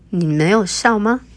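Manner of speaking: usual speaking voice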